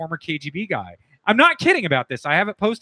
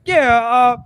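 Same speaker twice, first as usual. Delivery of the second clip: North Jersey wise guy; 7 semitones higher than usual. Retold or imitated. Impersonating a gregarious Jersey mob guy at a dinner, hammed-up accent. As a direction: ham up a North Jersey tough guy introducing himself. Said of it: "he literally talked like that".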